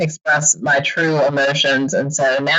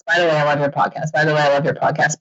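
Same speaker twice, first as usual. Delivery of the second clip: mock how often she hears it; rapid repetition; much faster than usual. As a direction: rattle the same line off fast, over and over. Mocking the repeated emails.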